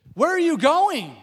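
loud, high, drawn-out cry; hammed-up panicked shout after someone leaving; freaking out. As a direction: call out in mock alarm. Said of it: "freaking out".

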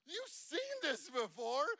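dad repeating the officer's calm words in shocked disbelief; incredulous echo. Retold or imitated. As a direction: throw the words back in disbelief. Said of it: "And I'm like".

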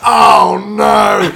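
loud, high comic shout of dismay; hammed-up horror at someone else's tears; mock dismay. Clerk reacting to a weeping customer, dismayed. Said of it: "she just looked at me like".

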